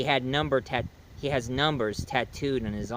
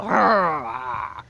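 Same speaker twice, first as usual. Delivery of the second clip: wolfman cry; 2 semitones higher, 4 dB louder than usual. Retold or imitated. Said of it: "going all".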